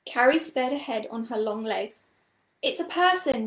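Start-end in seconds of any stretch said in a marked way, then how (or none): 2.6-3.5 raised, higher-pitched call; calling back. A narrator's reading. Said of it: "she called back".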